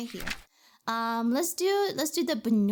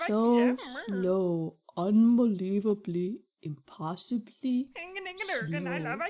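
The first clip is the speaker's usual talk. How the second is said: slow drawl; much slower than usual. Pretend. Voicing the sloth.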